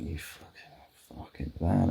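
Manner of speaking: muttering a curse, slow and under the breath; muttered curse. Retold or imitated. Walking home past the neighbour's van, a slow under-breath curse. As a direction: grumble it to yourself, slow.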